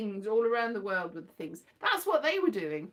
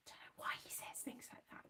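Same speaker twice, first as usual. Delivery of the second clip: whispered aside; 23 dB quieter, faster than usual. Real, not acted. Muttered aside.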